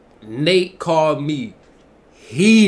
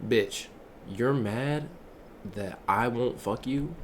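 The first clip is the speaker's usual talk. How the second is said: low, soft, deliberate disbelief; quiet incredulity; 5 semitones lower, 6 dB quieter than usual. Acted. Streamer addressing the rejected girl, low quiet incredulity. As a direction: drop low and soft, spacing out each phrase.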